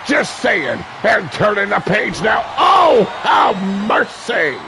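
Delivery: hyped wrestling announcer riding every big move; live play-by-play. Acted. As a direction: call the match live with big excited shouts. Live wrestling match commentary.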